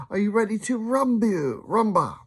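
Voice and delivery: high, building showman call; hyped host. Acted. Flavio firing up the screaming crowd, high and excited. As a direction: pump the crowd up, pitched high.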